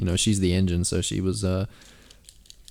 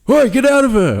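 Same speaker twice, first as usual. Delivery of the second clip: much higher, loud mock shout; furious dad yell; 9 dB louder than usual. Imagined dad yelling.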